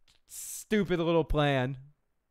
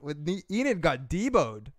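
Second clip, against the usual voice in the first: ordinary delivery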